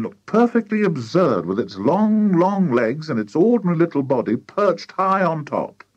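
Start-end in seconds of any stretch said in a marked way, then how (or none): none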